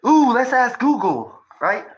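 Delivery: mock the viewer with fake enthusiasm; sarcastic. Speaker mocking a viewer who trusts Google, sarcastic and loud.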